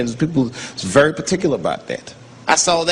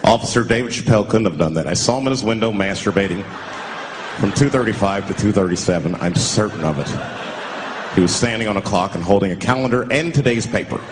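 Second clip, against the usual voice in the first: witness testimony; 5 semitones lower than usual. Pretend. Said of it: "imagined alibi witness testimony".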